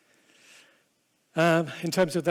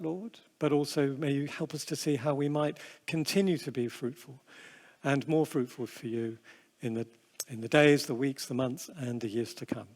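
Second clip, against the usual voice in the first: quiet, earnest prayer voice; 5 semitones lower, 5 dB quieter than usual. Acted. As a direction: pray softly over the congregation. Opening prayer before the talk.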